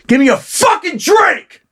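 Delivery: hammed-up drunken shout; mock drunk yell. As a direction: bellow it like a rowdy drunk demanding a drink. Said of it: "walk in there and go".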